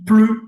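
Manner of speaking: PLUS drawn out, loud and high; serment appuyé. Retold or imitated. Serment raconté, mot appuyé. (« plus » appuyé, traînant et fort)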